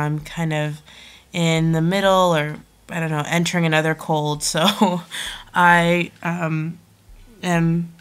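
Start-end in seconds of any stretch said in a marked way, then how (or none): none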